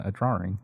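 "drawing" said as "drawring"; Irish-style pronunciation. Acted. Joker echoing an Irish way of talking, "drawing" as "drawring". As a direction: put an extra r in the middle of "drawing". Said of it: "That's what they say in Ireland".

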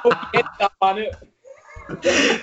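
slow words squeezed out through real laughter; laughing. Real, not acted. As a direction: laugh your way through the line, barely holding it. Laughing at his own joke.

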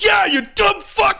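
high, slow shouted insult; high shouted agreement, slow; slow mock shout. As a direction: drag out the yell at the screen. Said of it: "you're like".

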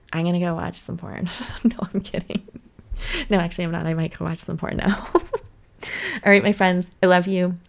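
ordinary talk, nothing marked

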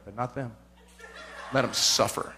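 low, with a laugh, spiteful; spiteful laughing aside. Retold or imitated. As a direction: chuckle it low and petty. Spiteful aside inside the prayer.

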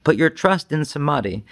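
usual speaking voice

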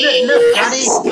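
put-on very high, loud little-kid voice; excited child. Child telling daddy about his driver account, high excited kid voice. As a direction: pipe up like a kid showing daddy.

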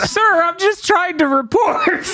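high, mock-whimpering underling; pleading subordinate. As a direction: plead in a comic high voice, half laughing. Acting out the backhanded subordinate.

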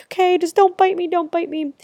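hammed-up scared whimper; pleading with a bug. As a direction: beg the horsefly to leave you alone. Pleading with swarming horsefly.

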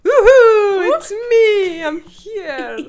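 high, loud whooping entrance; excited cheer. Real, not acted. Guest greets the comedy host with a loud excited cheer. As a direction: burst in cheering with laughter.

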